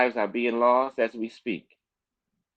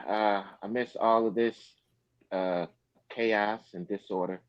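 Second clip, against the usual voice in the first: ordinary talk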